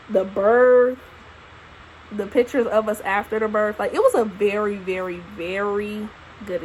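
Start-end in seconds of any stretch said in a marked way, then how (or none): none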